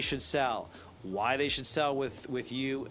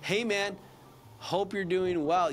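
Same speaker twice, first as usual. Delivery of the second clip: upbeat greeting; 7 semitones higher, slower than usual. Shown as a demonstration. Rep answers a cranky client, higher and upbeat. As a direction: lift a cranky client's mood. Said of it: "he sounds kinda cranky".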